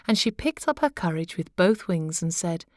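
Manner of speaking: ordinary delivery, nothing marked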